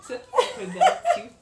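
high, loud, slow laugh through the words; laughing at her own English word; amused self-aware giggle. Real, not acted.

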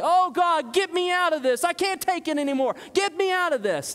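high, loud whiny plea; mock whimpering prayer to God; whining prayer. Shown as a demonstration. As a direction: whine it out like a fed-up complainer. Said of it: "That's just whining".

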